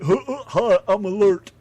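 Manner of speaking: mock Cockney greeting, high and loud with a laugh. Teasing his British co-host with a laughing mock Cockney. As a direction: tease the Brit with a put-on Cockney "Hi, I'm alert". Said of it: "I am not a Cockney".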